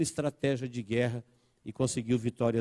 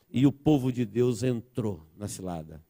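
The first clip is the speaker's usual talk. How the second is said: ordinary talk, nothing marked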